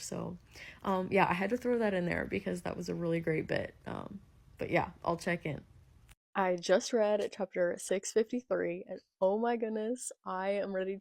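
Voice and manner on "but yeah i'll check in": normal talking voice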